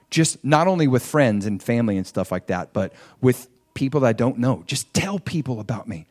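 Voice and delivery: normal talking voice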